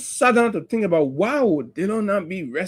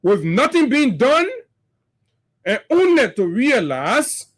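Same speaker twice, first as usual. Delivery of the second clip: impassioned oration; 5 semitones higher than usual. Acted. Speaker rallies Liberians against the ruling party, impassioned oration. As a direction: build it like a rally speech.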